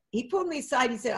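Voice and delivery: ordinary talk, nothing marked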